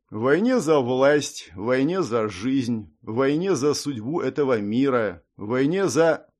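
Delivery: slow, solemn pronouncement; grandiose. Acted. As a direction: intone it like a prophecy. Lich's grandiose speech about war.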